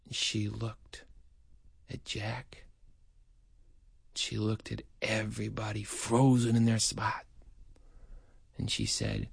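low, soft narration with long pauses; hushed suspense. A narrator's reading. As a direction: drop almost to a whisper. Hushed suspense after the outburst.

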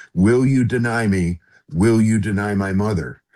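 normal talking voice

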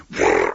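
loud fluttering puff of air; mouth-made blowgun burst; imitated blowgun shot. A narrator's reading. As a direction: blow out a loud dart-firing noise. Imitates blowgun darts being shot.

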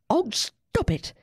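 put-on high, slow little-girl command; a light child's order, high and slow; mild order. A narrator's reading. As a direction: tell the squabbling animals off, gently bossy. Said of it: "Susie ordered".

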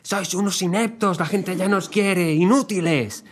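hammed-up scolding at high pitch; imitating angry shouting. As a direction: berate them like a furious voice note. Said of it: "mandó una nota de audio gritando".

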